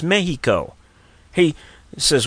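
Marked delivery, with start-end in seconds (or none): 0.0-0.7 "Mexico" said as MEH-hee-co; Spanish-style h in "Mexico"; Spanish pronunciation. Acted. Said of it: "that's how they pronounce it".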